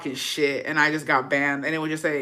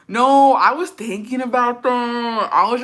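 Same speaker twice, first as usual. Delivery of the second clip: slow dreamy drawl; 6 semitones higher, 5 dB louder, slower than usual. Acted. Nostalgic drawn-out musing.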